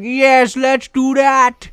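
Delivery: very high, loud caricature; eager plotter. Acted. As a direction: cheer it on, stretched and cartoonish. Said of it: "he's like".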